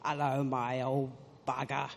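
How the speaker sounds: put-on child newcomer copying TV English accents; imitated English accent. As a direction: put on an English soap accent, a bit low. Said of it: "start to imitate all these English accents".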